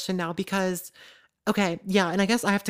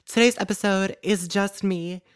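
ordinary delivery

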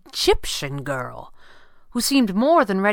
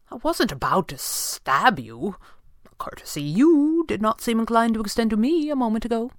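Fenwick's voice; 7 semitones higher, 3 dB louder than usual. Acted. Fenwick mocking the would-be stabber.

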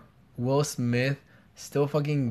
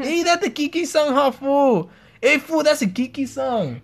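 put-on Mexican accent; 11 semitones higher than usual. Continues Mexican accent bit.

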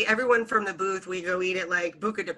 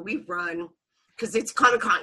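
ordinary talk, nothing marked

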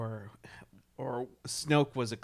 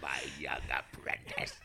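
Palpatine whisper; 11 semitones lower, 7 dB quieter than usual. Acted. Continuing the Palpatine bit.

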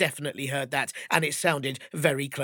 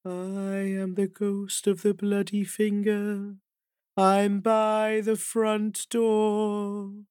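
slow, higher eerie ghost moan; 6 semitones higher, much slower than usual. Acted. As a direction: drawl it out like a distant ghost. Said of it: "a voice saying this".

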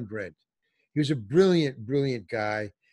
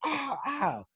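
high whimpering ow of pain; 12 semitones higher, 3 dB quieter than usual. Retold or imitated. Nursing his hammered thumb, high pained ows.

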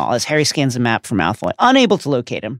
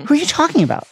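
high-pitched, lovesick bafflement; baffled question; 13 semitones higher, faster than usual. A narrator's reading. Ron blinking at Harry, clueless and high-pitched. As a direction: ask it high, honestly lost.

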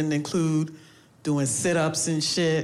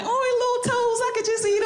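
put-on much higher, sweet baby-talk coo; cooing parent voice. Comic mimics people gushing over baby toes. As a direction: gush over a baby's toes. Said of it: "You know how people be like".

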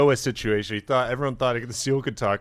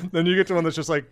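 normal talking voice

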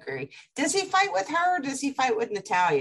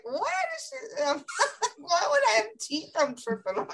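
baffled; 11 semitones higher than usual. Retold or imitated. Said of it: "I'm like".

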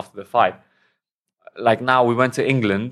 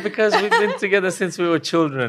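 laughing; 9 semitones higher than usual. Real, not acted. Laughs explaining himself.

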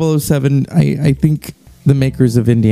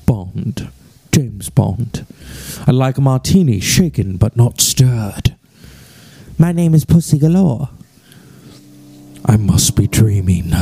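Bond impression. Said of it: "the man who first said".

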